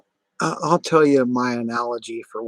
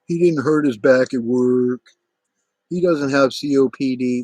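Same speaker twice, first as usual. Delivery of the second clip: louder, sneering mimic of the doubters; mocking the accusers; 3 semitones higher, 6 dB louder than usual. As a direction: throw their words back mockingly. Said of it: "mocks accusers' claims".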